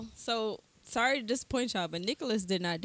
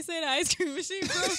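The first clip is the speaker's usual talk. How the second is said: fast food worker; 7 semitones higher, 4 dB louder than usual. Retold or imitated. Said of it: "And I told you to say".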